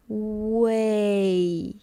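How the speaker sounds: WAY stretched out with its full end glide; long drawn-out WAY for learners; really slow. Shown as a demonstration. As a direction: say WAY really slowly. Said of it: "pronounce way really slowly".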